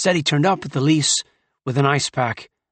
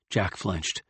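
ordinary delivery

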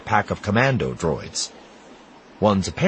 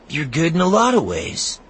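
put-on boy's voice; 11 semitones higher, 3 dB louder than usual. A narrator's reading. Temin comforting Sinjir.

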